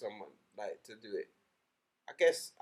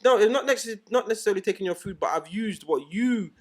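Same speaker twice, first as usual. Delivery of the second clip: loud, raised-pitch rebuttal; acted argument; 6 semitones higher, 9 dB louder than usual. Rival DJ answering the mixer he used, acted loud rebuttal. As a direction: argue back forcefully at high volume.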